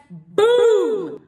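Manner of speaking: high, loud "boom"; imitated impact. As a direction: punch out the sound of a shove. Imitates the shove into his chest.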